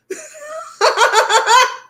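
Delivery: high, loud cackling laugh; loud, high peal of laughter; big laugh. Real, not acted. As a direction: burst out laughing at your own retort. Amused by her own retort.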